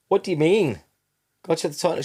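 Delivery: high, loud, incredulous question; retold bafflement. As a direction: throw the question back, pitched right up. Retold baffled reply to partner.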